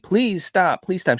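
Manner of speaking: imitated pleading. Husband begging his transforming wife, high mock plea. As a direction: beg in mock panic. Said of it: "he's like".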